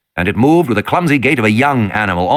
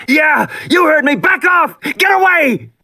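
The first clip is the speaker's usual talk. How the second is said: shouting at the top of his lungs; slower than usual. Acted. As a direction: yell it at full force, much higher. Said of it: "Regis was shouting".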